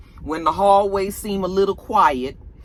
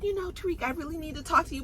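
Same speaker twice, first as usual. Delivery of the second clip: put-on pleading girlfriend; 9 semitones higher, 8 dB quieter, faster than usual. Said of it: "she was just like".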